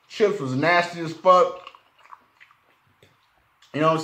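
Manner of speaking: talking while chewing food; words around a mouthful; mouth full. Real, not acted. Seen 0: Bites food at -2, then speaks with mouth working, likely chewing.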